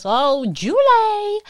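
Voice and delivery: high, loud, drawn-out name; big slow call-out of a welcome; delighted greeting. Real, not acted. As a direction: stretch the name into a cheer. Greets a chatroom arrival.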